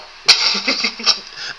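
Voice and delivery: high breathy laugh at the dead mic; airy frustrated chuckle. Real, not acted. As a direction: let out an exasperated laugh.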